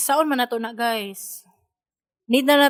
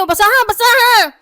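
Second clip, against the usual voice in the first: raised, high-pitched insistent urging; urgent loud command; 7 semitones higher, 7 dB louder than usual. Real, not acted. Co-host pressing the other to read the response aloud, urgent and loud. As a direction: demand it loudly, pitch climbing.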